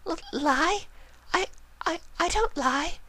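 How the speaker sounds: very high, panicked stammer. Acted. Rarity caught lying, high panicked stammer. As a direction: stumble over the words in panic. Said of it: "she stammered".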